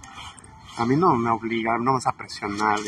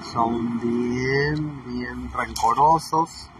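very slow, stretched words; slowly and heavily; drawn-out emphasis; much slower than usual. Real, not acted. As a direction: drag out bien rencorosos to stress it.

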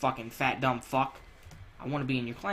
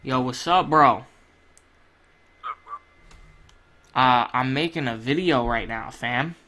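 louder, bragging call voice; pretend phone call; 3 semitones lower, 6 dB louder than usual. Fake calling a friend to brag about loot, louder. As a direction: play calling your buddy to brag.

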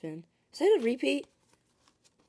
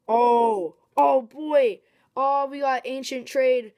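excited; 5 semitones higher, 8 dB louder, slower than usual. Real, not acted. Pulling a rare card.

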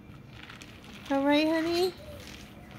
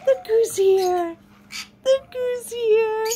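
high, slow delighted coo; excited cooing; 7 semitones higher, 3 dB louder, slower than usual. Real, not acted. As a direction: gasp then coo with delight. Excited greeting of pet.

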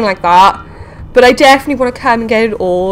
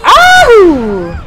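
startled; 6 semitones higher, 7 dB louder than usual. Real, not acted. Bumps into crash fish.

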